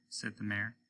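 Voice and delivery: usual speaking voice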